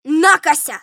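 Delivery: loud scornful jeer; contempt. A narrator's reading. General rebuffing the steward, contemptuous. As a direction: throw it out with scorn. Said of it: "сказал генерал с презрением".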